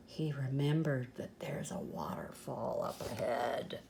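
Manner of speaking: low, soft suspense whisper. Acted. Storyteller hints at the waterfall in a low whisper. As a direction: whisper the danger to build dread.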